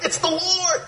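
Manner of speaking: high excited cry. Retold or imitated. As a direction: blurt it out in wonder. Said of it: "he's all excited".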